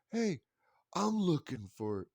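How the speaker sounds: put-on high, slow eager child's voice; kid voice. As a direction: draw it out like a curious little boy. Kid voice again.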